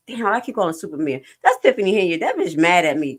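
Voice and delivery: usual speaking voice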